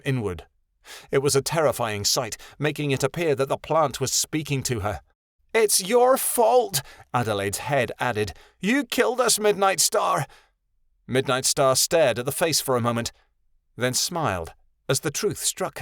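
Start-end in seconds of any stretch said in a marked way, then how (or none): 5.5-10.2 a ghostly little voice accusing; eerie accusing child. A narrator's reading. Adelaide's head accuses Midnight Star, eerie high.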